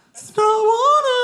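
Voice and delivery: very high, smug bratty boast; cocky kid. Retold or imitated. Cocky boy brags to sister's friend, high smug. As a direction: gloat like a cocky eleven-year-old. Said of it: "I was so cocky, I'm like".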